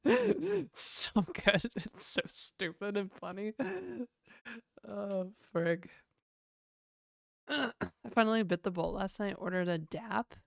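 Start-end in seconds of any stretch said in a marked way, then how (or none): none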